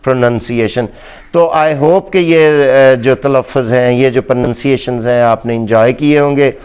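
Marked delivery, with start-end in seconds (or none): 0.0-0.9 प्रोनंसिएशन with short nun; प्रोनंसिएशन pro-nun-see-AY-shun; प्रोनंसिएशन corrected form; correct pronunciation. Shown as a demonstration. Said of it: "syllables में भी".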